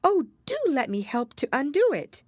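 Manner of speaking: earnest, high-voiced Alice; anxiously helpful. A narrator's reading. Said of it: "looking anxiously about her".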